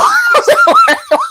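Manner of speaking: shrill real laugh; high burst of laughter. Real, not acted. Laughing at own joke.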